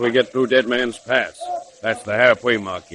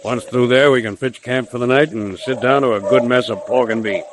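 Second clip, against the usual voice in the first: usual speaking voice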